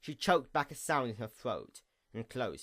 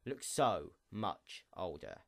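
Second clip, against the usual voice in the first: usual speaking voice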